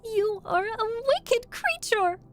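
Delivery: put-on very high, loud little-girl protest; crying out angrily. A narrator's reading. Dorothy scolding the witch, crying out indignantly. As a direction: accuse in a high, girlish cry. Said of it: "cried Dorothy".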